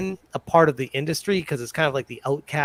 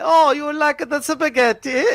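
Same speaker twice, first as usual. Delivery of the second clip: put-on higher, louder caricature Italian accent; mock Italian accent; 10 semitones higher, 4 dB louder than usual. Italian guest mocks Simpsons Luigi in Italian accent. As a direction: ham up a cartoon Italian waiter accent. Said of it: "caricature version of an Italian guy".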